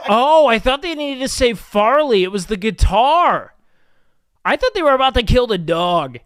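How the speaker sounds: loud, startled outburst over a mix-up; loud realization. Real, not acted. Streamer reacting aloud to a clip he misread, loud realization. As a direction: blurt it out well above talking volume.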